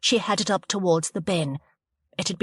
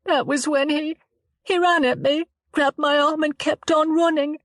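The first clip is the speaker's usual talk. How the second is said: put-on higher voice for the upset young woman; a frightened girl telling it haltingly; shaken young woman Chloe; 10 semitones higher, 4 dB louder than usual. Chloe telling the detective why she was alone, higher, shaken.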